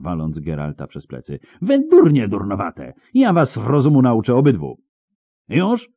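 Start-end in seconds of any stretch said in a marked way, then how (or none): 1.6-4.8 high, loud tongue-lashing; furious high scolding; angry shriek. A narrator's reading. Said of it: "wrzasnęła z trzaskiem". 5.5-5.9 very high, sharp demand; high-pitched angry check; shrieked question. A narrator's reading. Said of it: "wrzasnęła jeszcze głośniej".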